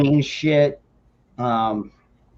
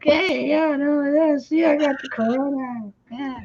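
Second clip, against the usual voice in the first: much higher, silly put-on kid voice; mock kid voice; 3 dB quieter than usual. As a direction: ham it up like a goofy kid. Mocking son showing the can.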